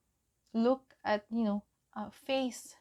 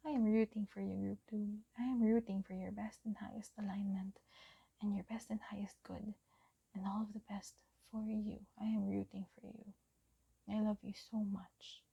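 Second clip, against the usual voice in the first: tender whispered affirmation; soft whisper; 5 dB quieter, faster than usual. Acted. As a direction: whisper love and support close to the mic.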